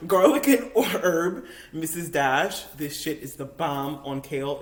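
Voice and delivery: ordinary talk, nothing marked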